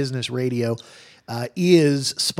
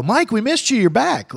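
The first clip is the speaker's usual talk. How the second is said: excited greeting; 10 semitones higher, 5 dB louder than usual. Retold or imitated. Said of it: "Harper will go".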